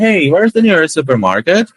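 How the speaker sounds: breezy, like a local asking; casual native-like English. Retold or imitated. Traveller asking a stranger abroad, easy native-sounding English. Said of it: "Eu chego na pessoa e falo".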